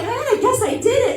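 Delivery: high, small laughing confession; defeated little kid. Retold or imitated. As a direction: give in like a scared child. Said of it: "I'm like".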